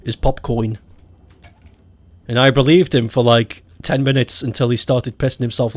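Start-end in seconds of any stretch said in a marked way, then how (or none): 0.2-0.8 "popcoin" with an oy in the last syllable; fake Australian pronunciation. Retelling the Australian's prank word, "popcoin" with oy. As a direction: say "popcoin", rhyming the end with coin. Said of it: "how they say popcorn".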